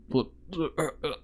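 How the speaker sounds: bubbling blub-blub noise, then weak grunts; gurgling sound. Retold or imitated. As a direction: mimic a man choking on blood, quietly. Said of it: "he's like".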